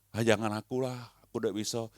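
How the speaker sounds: soft, whiny reluctant voice; reluctant excuse. Retold or imitated. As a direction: mimic someone shirking. Reluctant member quoted. (suara pelan mengelak dengan malas)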